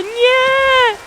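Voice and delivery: plain reading voice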